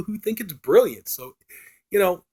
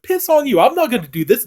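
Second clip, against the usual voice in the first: high, slightly louder mock defiance; funny defiant retort; 9 semitones higher, 4 dB louder than usual. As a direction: lighter rerun of the defiance. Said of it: "it's kind of funny the way she says it".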